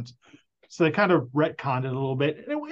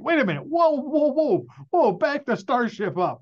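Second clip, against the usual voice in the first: high, hammed-up whoa whoa, much higher; mock alarmed protest; 11 semitones higher than usual. Viewer protesting the dropped reveal, mock alarmed and much higher. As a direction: pretend to slam the brakes in disbelief.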